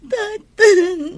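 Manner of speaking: high, loud tearful wail; crying loudly. Acted. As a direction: wail the word. Daughter cries to scolding father. (agsangsangit a napigsa)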